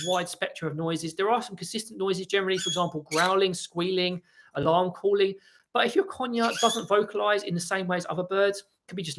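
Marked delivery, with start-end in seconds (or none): none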